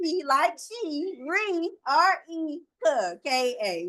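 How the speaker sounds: letters spelled out slowly and clearly; slow spelling. Shown as a demonstration. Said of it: "I will spell my name for you".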